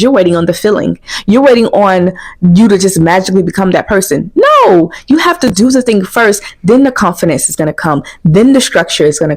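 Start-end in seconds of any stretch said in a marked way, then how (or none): 4.3-4.9 No, thrown up high and punchy; high, forceful No; emphatic no. Real, not acted. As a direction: slam the brakes with a sharp No. Cutting off the waiting excuse.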